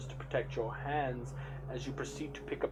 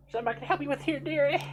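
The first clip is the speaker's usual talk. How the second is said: put-on much higher and louder, a lightly done Southern old lady's accent; a folksy Southern shopkeeper's accent calling "dearie"; 9 dB louder, faster than usual. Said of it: "everybody's just gonna have Southern accents".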